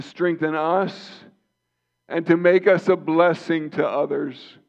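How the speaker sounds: high, loud, soaring preacher's call; rising fervent sermon voice; full-force preaching. Acted. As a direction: lift the room like a revival pulpit.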